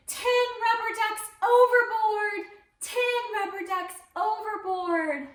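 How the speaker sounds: high, loud alarmed cry from the deck; captain's shout. Acted. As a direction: call it out like a panicked captain. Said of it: "the captain shouted".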